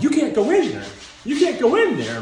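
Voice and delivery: high, loud urgent warning; acted frantic protest; alarmed doctor. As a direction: shout to block someone at the door. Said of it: "and doctor's like".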